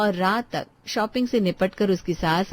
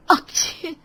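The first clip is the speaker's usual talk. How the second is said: high-pitched sneeze; acted sneeze; 9 semitones higher, much slower than usual. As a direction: sneeze out a quick achoo. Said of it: "उसे छींकें आने लगती है".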